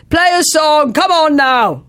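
high-pitched heckler's holler; retold scream. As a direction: imitate someone screaming at the band. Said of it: "stood up and screamed".

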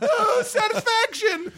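very high, slow star voice; sing-song awe at the rock star; mock [name]. Imitating [name] line.

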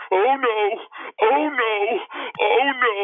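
very high, loud drawn-out cry; comic falsetto hollering; retold court holler. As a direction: wail it out while running off, hammed-up. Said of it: "ran back down the court going".

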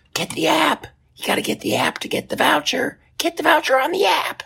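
very high squeaky airline agent voice; shrill-pitched mock of the pushy app pitch. Comedian mocking the airline pushing its app, in a very high squeaky voice.

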